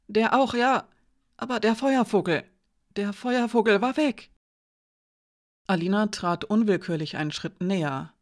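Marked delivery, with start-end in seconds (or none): 0.1-4.3 higher, agitated confession; nervous man in raised pitch; flustered accused servant, distressed. A narrator's reading. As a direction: insist anxiously on the real loss.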